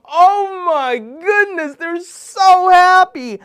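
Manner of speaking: delighted. A narrator's reading. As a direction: gush over their joy. Narrator shares joy.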